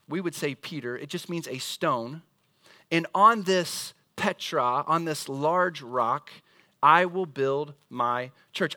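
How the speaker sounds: slow, drawn-out Petros and Petra; slow reading. Shown as a demonstration. Preacher reads the Greek sense to the church, slowly. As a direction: read it out word by word. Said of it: "it would read something like this".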